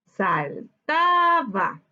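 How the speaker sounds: "Saltaba" slowly split into sal, ta, ba; very slow, segmented "Saltaba"; syllable by syllable. Shown as a demonstration. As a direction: sound out "Saltaba" in separate syllables, lifting the middle one. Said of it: "Separemos por sílaba saltaba".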